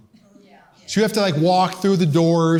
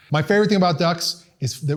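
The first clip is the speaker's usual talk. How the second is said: ordinary delivery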